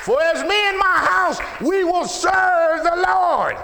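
high, full-force preaching shout; roaring declaration of faith; shouted scripture. Real, not acted. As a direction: belt out the verse, stretching the end.

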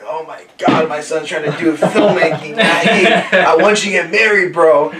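imitating an exasperated dad, pitch rising as it goes; mock freaking out. Son imitating his dad's reaction to filmmaking, mock freak-out. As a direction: playfully act out a parent's panic, half laughing. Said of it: "my dad's, like, freaking out, like".

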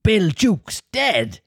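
high, drawn-out astonishment; startled cry. Acted. Pirates hearing of their shipmate's death, startled high cry. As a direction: cry it out slowly in shock. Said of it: "cried the startled pirates".

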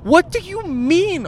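high, slow incredulous retort; high-pitched mock disbelief. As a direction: throw her question back at her, baffled. Mocking her question in disbelief.

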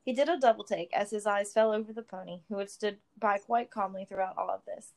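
usual speaking voice

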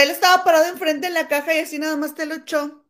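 ordinary talk

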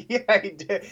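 high laugh spilling into the words. Real, not acted. Laughing at his co-host's story about Rodgers. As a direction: cracks up, then agrees through the laugh.

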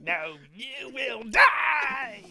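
slow menacing emperor; Emperor Palpatine voice. Retold or imitated. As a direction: drag it out like a Sith lord. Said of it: "imitating Emperor Palpatine".

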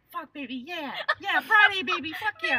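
high, loud hype-man cheer; TikTok party guy. Acted. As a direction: whoop it up like a Friday hype video. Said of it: "You guys have never seen this guy".

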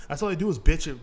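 normal talking voice

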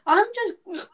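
hiccuping mid-sentence; words broken by a hiccup. Real, not acted. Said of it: "I have the hiccups".

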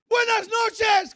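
high, loud, long-drawn call; shouted greeting. Acted. Preacher voicing God's night greeting, shouted. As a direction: stretch the greeting out in a big shout.

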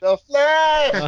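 high, loud cry of the answer; real burst of excitement; excited confirmation. Real, not acted. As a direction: shout it out in high-pitched delight. Host confirms the correct answer.

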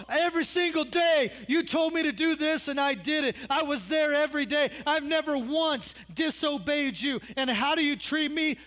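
put-on older son ranting at his father; indignant older son. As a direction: rant like the wronged older son. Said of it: "He's all".

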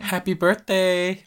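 high, slow excited cheer. Acted. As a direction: sing out a happy birthday. Celebrating film anniversary.

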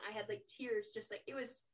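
normal talking voice

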